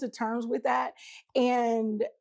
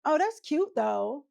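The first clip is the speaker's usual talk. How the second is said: slow, higher, nice-nasty compliment; 4 semitones higher, slower than usual. Retold or imitated. Co-wife downplaying what you have, mildly nice-nasty. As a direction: lightly mimic phony niceness, drawn out. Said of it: "it's this nasty, nice thing".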